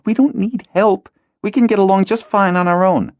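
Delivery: put-on a girl insists they need no help; defiant girl. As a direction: refuse flatly, proud. Hala rejects help.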